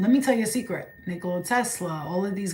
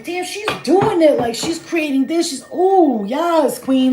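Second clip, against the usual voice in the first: excited cheering; 6 semitones higher, 5 dB louder, slower than usual. Retold or imitated. Acted admiring cheer for artist.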